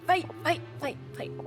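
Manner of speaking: normal talking voice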